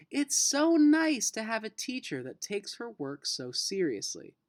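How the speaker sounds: a hint of a delighted parent; pleased mom. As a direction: mom gushing about the teacher. Mom pleased by teacher's letter.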